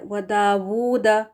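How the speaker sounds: वदावूदा said slowly, the w clear; slow demo. Shown as a demonstration. Teacher presenting the next word slowly. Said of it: "वाव उल्टा पेश है इधर".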